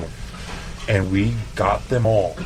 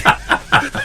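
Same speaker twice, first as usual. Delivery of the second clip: breathy laugh. Real, not acted. Laughing at his own story.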